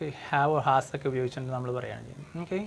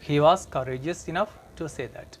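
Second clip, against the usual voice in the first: slow model sentence; much slower than usual. Shown as a demonstration. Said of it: "teacher reads model sentence".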